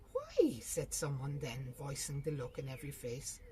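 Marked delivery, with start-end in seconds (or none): 0.1-0.6 sharp high loud question; genuinely taken aback; astonished why. A narrator's reading. Family stunned that Lally won't stay, high astonished.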